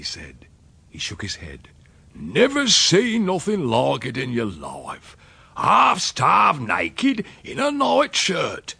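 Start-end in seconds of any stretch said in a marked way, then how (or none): 2.1-8.7 high, slow, drawn-out country dialect; rustic old uncle, astounded. Acted. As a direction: marvel loudly at the memory.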